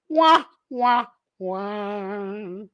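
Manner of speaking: mock "wah, wah, wah" sinking on a long last note; sad trombone imitation. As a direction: sing out a comic failure sting. Sad trombone for Raiders' failures.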